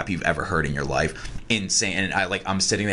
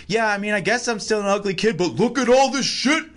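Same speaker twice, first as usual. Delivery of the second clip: high, louder boastful rapper voice; imitating the rapper; 14 semitones higher, 3 dB louder than usual. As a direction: brag it up in a pinched high voice. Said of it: "and now he's like".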